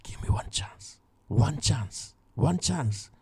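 hushed plea, barely voiced; whispering. Soldier beside sleeping Saul begs for one chance, whispering. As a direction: whisper the plea to the king so Saul won't wake.

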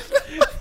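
very high shrieking laugh; pterodactyl shriek. Real, not acted. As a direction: screech like a bird laughing. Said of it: "You sound like a fucking pterodactyl".

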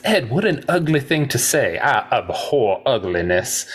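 drawn-out Southern drawl; wounded Southern gentleman, lilting drawl; Doc Holliday. Acted. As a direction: quote it in a playful Southern drawl. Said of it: "I'm gonna bust out the Southern drawl".

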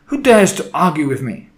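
loud, high imperious challenge; snapped. A narrator's reading. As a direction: demand the culprit's name in outrage. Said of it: "she snapped".

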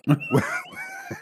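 sudden shrieking laugh, high. Real, not acted. Laughing outburst at the call.

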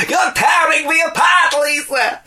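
high shouted strained cry, far above normal; over-the-top anguished shout; Tommy Wiseau impression. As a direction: wail it like a melodramatic movie line. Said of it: "they said".

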